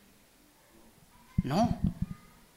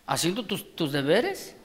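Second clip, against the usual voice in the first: ordinary talk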